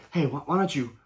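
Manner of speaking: quiet, coaxing imitation of the streamer; soft and sly, calling the kid over. Vlogger mimics streamer quietly luring his kid over. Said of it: "the streamer goes".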